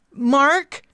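loud high call across room; yelling. Acted. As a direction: yell after a passerby. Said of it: "She yelled behind me".

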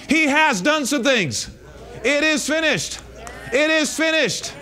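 forceful preacher's proclamation, each statement driven home; impassioned preaching. Real, not acted. Preacher declares to church what is already done, impassioned preaching. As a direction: preach it hard in short declarations.

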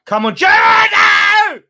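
high loud yell of hope then despair; high yelling at a chance; desperate shout. Real, not acted. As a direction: scream him on, then the miss. Near miss on goal.

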